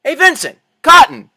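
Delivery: shouting to friends far off; calling out. Acted. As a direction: call across the distance.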